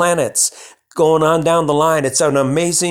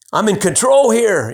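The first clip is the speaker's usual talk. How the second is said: mock little voice; 6 semitones higher than usual. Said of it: "your little voice saying that".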